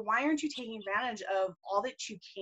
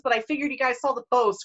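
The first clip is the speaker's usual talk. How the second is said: ordinary delivery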